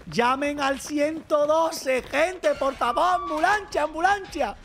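high, drawn-out pretend panic; playful wailing call for an ambulance; mock emergency plea. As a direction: beg the crowd to call for help, hammed up.

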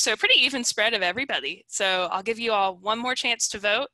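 normal talking voice

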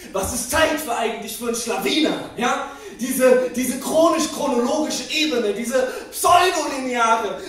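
mounting mock indignation; indignant rant. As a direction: work yourself up into a tirade. Stage rant building against time.

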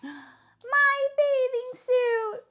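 high, loud, drawn-out wail; shouting. A narrator's reading. Froggy shouts to his mother from the pond, high stretched yell. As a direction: shout it slowly in hammed-up distress. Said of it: "he shouted".

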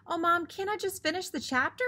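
put-on whiny kid plea. A narrator's reading. Hubie begging for more reading.

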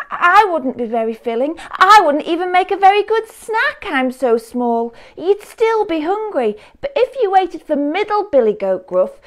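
high, nervous pleading; high little goat voice. A narrator's reading. Smallest goat begging the troll to let him cross, high and nervous. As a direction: squeak it out, talking your way past the troll.

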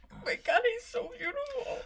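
soft and breaking, pitch up; sobbing through the words; snotty crying. Real, not acted. Weeping over dog's doll.

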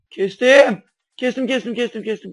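loud, high, triumphant repeats; excited shout. Real, not acted. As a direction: cheer it out as you cut. Kesince sevinçle bağırıyor. (kesmeyi başarınca coşkulu, yüksek bağırış)

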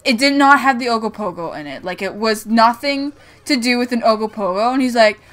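ordinary talk, nothing marked

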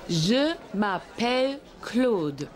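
very slow careful French; slow demo. Shown as a demonstration. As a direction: stretch Je m'appelle Claude out slowly. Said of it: "Hör bitte gut zu".

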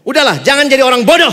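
loud, firm rebuke; loud scolding. Retold or imitated. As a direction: tell him off hard. Mentor scolds stubborn student.

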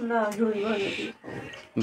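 soft and slow, worn out; weak sick voice. Real, not acted. Feverish wife telling husband what she wants with tea, soft and slow. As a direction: drag the words, feverish.

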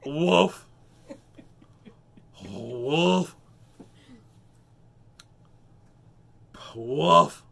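loud single woofs; punchy imitation barking; dog bark impression. Shown as a demonstration. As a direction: woof loudly like a big dog. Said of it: "I'm a master impressionist".

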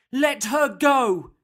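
loud, high, drawn-out demand; small boy's bold yell; brave shout. A narrator's reading. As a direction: shout it defiantly, stretching each word. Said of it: "he shouted in his deepest voice".

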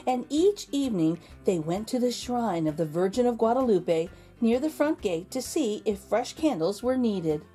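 ordinary delivery

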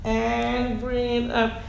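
drawn out, very slow; super slow. Shown as a demonstration. Teacher slowing down the move for students, very slow. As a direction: stretch every word like the move. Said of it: "Super slow".

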